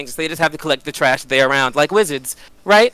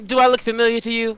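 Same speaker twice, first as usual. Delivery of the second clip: lightly voiced, higher-pitched Penny; character question; 8 semitones higher than usual. Penny pokes her head out to ask the goblins, higher in-character voice. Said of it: "and she goes".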